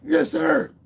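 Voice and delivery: high, loud recruit's yes sir; recruit shout. Retold or imitated. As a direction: bark it back like a recruit. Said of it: "So I'm like".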